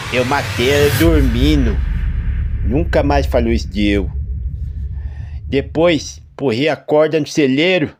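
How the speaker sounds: high, loud confession; unhinged farmer admitting killing; deranged farmer. A narrator's reading. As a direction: calmly confess murder, half mad.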